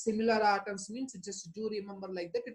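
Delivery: ordinary delivery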